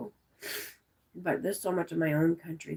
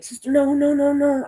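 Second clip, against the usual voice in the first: loud, high-pitched pleading no's; retold panic, protesting loudly; panicked refusal; 9 semitones higher, 10 dB louder than usual. Telling sister about the big slugs, panicked.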